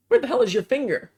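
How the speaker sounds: fast, alarmed question; shocked, fast and high. Shown as a demonstration. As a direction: blurt it out in alarm. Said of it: "repeated line".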